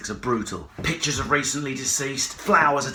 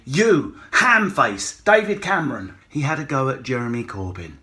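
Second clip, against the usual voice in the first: ordinary talk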